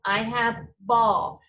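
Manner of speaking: "ball" said slowly with a long "aw"; modeling bawl. Shown as a demonstration. Teacher models a vowel word for the class, slow. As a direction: draw out "ball" to show the aw sound. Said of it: "not the kind of ball we play with".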